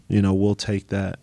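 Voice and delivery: ordinary talk, nothing marked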